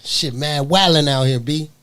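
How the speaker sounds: put-on loud, brash New York accent. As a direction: bark it like a New York guy. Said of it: "do the New York accent tonight".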